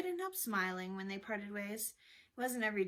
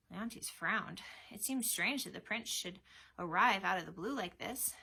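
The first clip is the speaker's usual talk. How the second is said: ordinary delivery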